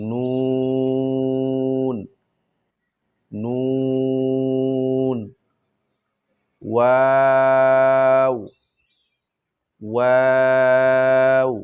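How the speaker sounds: কাফ, লাম, মিম, নুন, ওয়াও each held very long; tajweed drill of stretched letter names; long-drawn letter names. Shown as a demonstration. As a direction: draw out every letter name in a slow chant. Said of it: "তিন আলিফ পরিমাণ টান দিলে".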